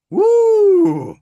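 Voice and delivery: long, high, loud "woo"; high loud whoop. Real, not acted. Coach overcome by John's sad story, loud whoop. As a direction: let out a sustained high whoop.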